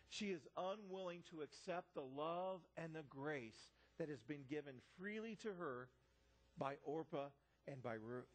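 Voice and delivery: normal talking voice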